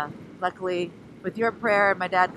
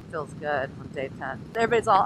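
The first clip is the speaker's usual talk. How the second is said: ordinary talk, nothing marked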